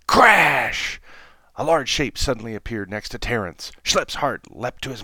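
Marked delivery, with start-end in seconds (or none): 0.1-1.0 shouted sound effect, much louder; loud crash. A narrator's reading. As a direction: bang it out like a crash.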